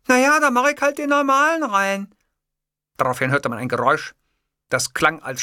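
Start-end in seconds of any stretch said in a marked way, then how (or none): 0.1-2.1 high, louder secretary voice. A narrator's reading. Secretary settling on the normal ringtone, high character voice. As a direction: answer high and loud as the secretary.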